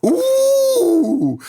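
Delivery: put-on high sing-song Swedish accent. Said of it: "Is that your Swedish accent?".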